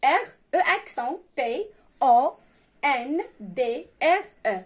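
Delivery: letters and accent named one by one, slowly; slow spelling. Shown as a demonstration. Teacher spelling Répondre slowly for pupils. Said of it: "تكتب كما تنطق".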